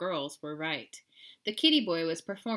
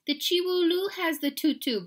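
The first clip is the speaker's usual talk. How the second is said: put-on high, louder little-girl voice; little sister; 10 semitones higher, 6 dB louder than usual. A narrator's reading. Sister tattles to mama, high girlish voice. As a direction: chirp the answer up high.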